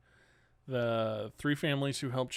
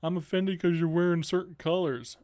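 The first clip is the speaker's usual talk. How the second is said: mocking whiny quote; 5 semitones higher, 6 dB louder than usual. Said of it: "That is the stupidest thing ever".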